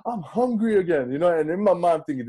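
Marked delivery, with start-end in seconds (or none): 0.0-1.1 put-on gasp, then higher-pitched baby whine; crying baby's voice. Dad voicing his hungry baby, higher gasping cry. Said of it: "And he was like".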